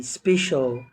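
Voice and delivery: "special" repeated with a clear SH for the C. Shown as a demonstration. Teacher repeating the word for learners, C as SH in "special". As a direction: model "special" with SH in the middle. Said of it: "هنطق الكلمة تاني".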